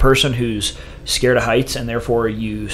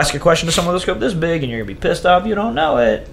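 higher and louder, rising heated lecture; retold warning; 7 semitones higher, 5 dB louder than usual. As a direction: hammer the warning home, getting higher. Doc friend warns retold.

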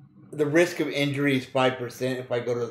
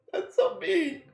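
much higher, laughing through the words; squealing laugh; slower than usual. Real, not acted. Scolding herself in-game while laughing high. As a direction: giggle it out in delight.

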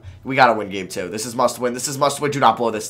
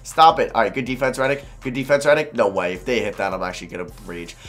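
normal talking voice

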